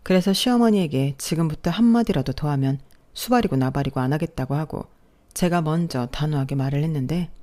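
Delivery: usual speaking voice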